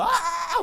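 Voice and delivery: put-on high, thin wail of a crying child; imitated crying. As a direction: mimic a kid's drawn-out sob. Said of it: "울고 있는 거야".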